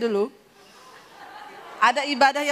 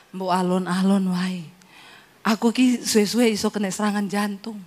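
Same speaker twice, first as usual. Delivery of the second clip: husband's low voice; 6 semitones lower than usual. Retold or imitated.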